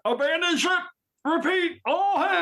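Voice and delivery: high-pitched starship alert call; shouting abandon ship. Retold or imitated. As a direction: belt out the evacuation order like a captain. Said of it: "stop doing impersonations".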